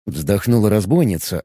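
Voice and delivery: ordinary delivery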